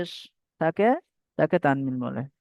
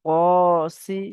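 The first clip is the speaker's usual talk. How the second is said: usual speaking voice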